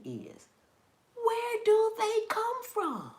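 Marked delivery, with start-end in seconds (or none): 1.1-3.1 baffled. Real, not acted. Puzzled about worm origins.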